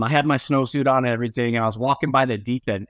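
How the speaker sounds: ordinary talk, nothing marked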